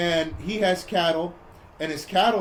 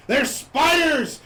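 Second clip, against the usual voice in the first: higher, slow, drawn-out exclamation; dramatic reveal; 7 semitones higher, 4 dB louder, much slower than usual. Retold or imitated. Storyteller revealing what is in the plane, dramatic reveal. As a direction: announce the horror with mock drama.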